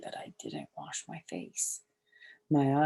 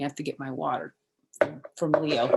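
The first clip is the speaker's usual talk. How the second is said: ordinary delivery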